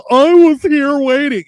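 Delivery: high, loud, drawn-out mock yell; slow, shouted whine of impatience; indignant complaint. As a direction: imitate an outraged customer hollering. Mimics an impatient complaining customer.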